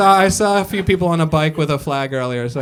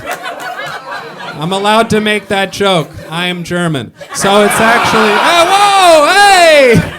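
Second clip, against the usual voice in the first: much louder, higher shouts over the crowd; real shouting at hecklers; 6 semitones higher, 7 dB louder, much slower than usual. Real, not acted. Comic shouting back at a heckling crowd, real and loud. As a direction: yell to calm the rowdy audience.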